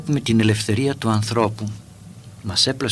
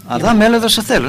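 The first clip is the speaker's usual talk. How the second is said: high, raised order to come at once; commanding summons; 10 semitones higher, 4 dB louder, faster than usual. Retold or imitated. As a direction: summon him sharply, no room for refusal. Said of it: "Θα μπορούσε να του πει".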